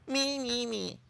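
high, fading whiny "me"; sing-song the selfishness, mocking; mock whine.

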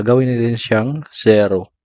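usual speaking voice

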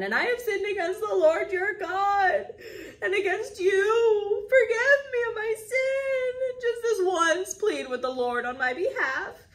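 a king whimpering for forgiveness; whining, weepy Pharaoh. A narrator's reading. Pharaoh pleading with Moses after the locusts, high weepy whine.

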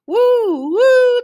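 high, loud "woo" train whistle; sustained, high-pitched train hoot. A narrator's reading. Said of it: "the train whistled".

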